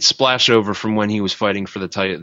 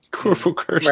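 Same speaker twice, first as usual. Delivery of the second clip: amused; 10 semitones higher than usual. Real, not acted. Laughing at an old wrestler name.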